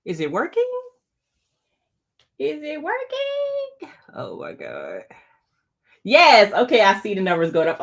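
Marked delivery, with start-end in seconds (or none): 2.4-3.9 much higher and slow, drawn-out worry; high drawn-out is it working; anxious high question. Real, not acted. Checking the stream tool. 4.1-5.0 soft breathy whisper, dismayed; whispered oh my God. Real, not acted. Stream tool problem.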